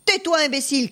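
mildly shouted anger. A narrator's reading. Farmer silencing the wailing peasant, mildly shouted anger. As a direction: silence him with a storyteller's mild shout. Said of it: "cria le fermier curieux".

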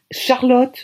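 balbutié, un peu plus aigu; balbutiement. A narrator's reading. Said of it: "je balbutie". (balbutié d'une voix hésitante)

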